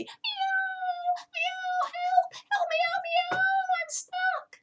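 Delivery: very high, wailing meows for help; piteous wail. Acted. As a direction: cry out like a trapped kitten. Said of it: "he began to wail piteously".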